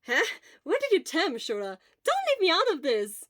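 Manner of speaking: high, loud indignant exclaim. Acted. Hisashi butting into the chat, loud exclaim. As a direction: cut in, don't leave me out. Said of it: "Hisashi exclaimed".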